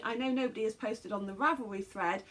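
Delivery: ordinary delivery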